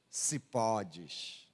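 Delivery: very low, soft quoted reply; softly voiced, low answer; quiet reply. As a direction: drop right down, low and soft. Quotes Jesus's measured reply.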